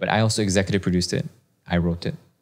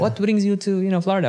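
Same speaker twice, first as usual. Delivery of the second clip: much higher put-on voice for the Puerto Rican driver; imitating Victor the driver; 11 semitones higher than usual. Driver greeting the arriving guest, high put-on voice. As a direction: play the friendly host asking a guest a question. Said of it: "so it turns out he's Puerto Rican".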